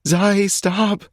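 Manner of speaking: high female voice, pleading; girlfriend pleading. A narrator's reading. Alex begging Zai to stop, high female plea.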